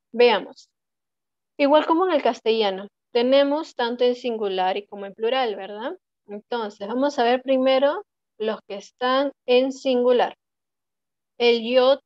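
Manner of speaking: ordinary delivery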